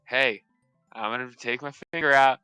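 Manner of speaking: usual speaking voice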